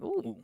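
high, soft "ooh" of shock; hushed, high-pitched reaction; wincing "ooh". Retold or imitated. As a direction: let out a small high ooh at a bold question. Reacting to a daring question.

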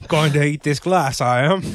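hammed-up mock Australian; attempted Australian accent. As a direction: playfully copy an Australian accent. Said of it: "in Australian".

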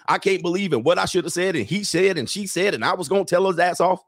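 running list of gossipy complaints; mock constant complaining. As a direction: imitate a woman reeling off grievances. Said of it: "It's just constant complaining".

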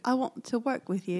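a soft, careful British accent; a hesitant first try at a British accent. Shown as a demonstration. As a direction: say it quietly like a Brit. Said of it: "Oh, that's pretty good".

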